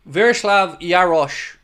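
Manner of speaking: slow, careful Virslav Yarosh with a sh ending; careful pronunciation. Shown as a demonstration. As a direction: spell out Yarosh slowly, ending on sh. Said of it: "It's pronounced like S H O".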